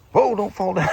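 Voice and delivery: sudden high-pitched whoa; startled warning. Real, not acted. Walker outdoors catching a near fall, startled.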